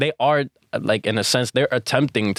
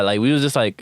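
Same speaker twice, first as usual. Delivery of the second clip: normal talking voice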